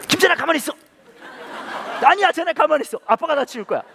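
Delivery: high, loud flustered insistence; frantic dad. Retold or imitated. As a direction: rush to take over, shouting. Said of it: "제가 미친 듯이 같이 치우고". (아빠가 허둥지둥 큰 소리로 말리듯)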